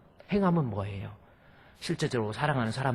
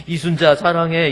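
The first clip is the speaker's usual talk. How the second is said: louder, earnest declaration of love; looking her in the eyes; 5 semitones higher, 10 dB louder than usual. Shown as a demonstration. Pastor demonstrating how to tell the wife looking in her eyes, earnest. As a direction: say it straight to her face. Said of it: "눈을 보고 이 얘기 해야지".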